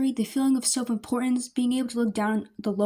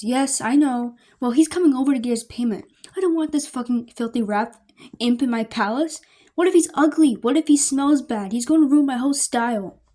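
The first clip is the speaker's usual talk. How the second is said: bratty rant; 3 semitones higher, 7 dB louder than usual. Acted. Spoiled sister ranting about imp.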